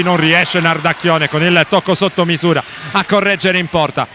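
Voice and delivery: ordinary delivery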